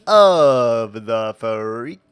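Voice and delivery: loud, drawn-out announcer intro; showy announcer greeting. Acted. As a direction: stretch it out like a grand showman. Theatrical opening greeting to the stream.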